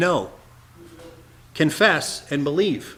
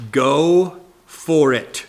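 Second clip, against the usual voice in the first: go for it; 2 semitones lower, 6 dB louder, much slower than usual. Retold or imitated. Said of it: "you could say".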